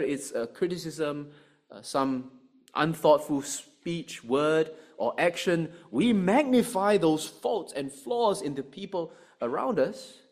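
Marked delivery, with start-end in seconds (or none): none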